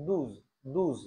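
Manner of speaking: "দুজ" as douze, oo then buzzing z; French oo vowel. Shown as a demonstration. Teacher drilling French twelve, "দুজ" with oo. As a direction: clear oo in "দুজ", ending on z. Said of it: "আমরা এখান থেকে বলি ও".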